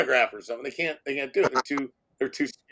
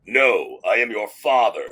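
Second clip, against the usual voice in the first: low Darth Vader voice; 6 semitones lower than usual. Retold or imitated. Actor answers in Vader line.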